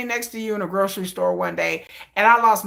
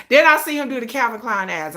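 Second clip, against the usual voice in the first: ordinary talk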